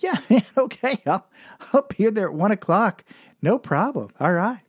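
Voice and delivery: overeager high voice, laughing; chirpy forced enthusiasm; eager high-pitched yes. Retold or imitated. Eager yes to temp job.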